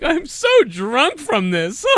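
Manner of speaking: put-on high, slow drunken drawl; mock drunk. Imitating drunk Tommy, slow high drunken voice. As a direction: slur it slowly and high.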